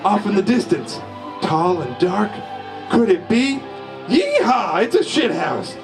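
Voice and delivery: usual speaking voice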